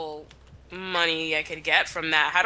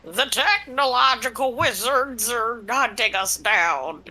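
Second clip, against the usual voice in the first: slow, ominous wizard doom-saying; a slow, portentous old sorcerer; ancient wizard voice; 3 semitones higher, slower than usual. Acted. Friend does a slow wizard voice warning of tech. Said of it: "I love that voice".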